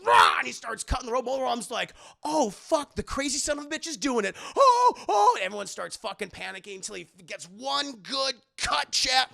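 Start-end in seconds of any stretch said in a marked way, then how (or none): 0.0-0.4 loud high roar; hammed-up battle roar; war cry. 2.2-5.3 higher, louder alarmed cries; hammed-up villain fright; mock panic.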